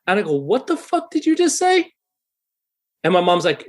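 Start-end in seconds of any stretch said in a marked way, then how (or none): 0.4-1.9 retold angry challenge, pitched much higher; angry retort. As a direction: reenact snapping back at the guy who insulted mom. Retold confrontation with band member.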